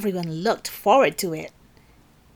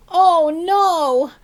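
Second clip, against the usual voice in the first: high, loud oh no; teacher alarmed by crashing books; dismayed cry; 9 semitones higher, 7 dB louder than usual. Acted. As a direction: cry out at the mess.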